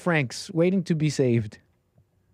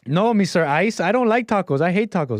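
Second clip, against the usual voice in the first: higher, mock-pleading comic voice; panicky hiding woman; 4 semitones higher than usual. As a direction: play a hider fending off an officer. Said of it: "she says she doesn't want tacos anymore".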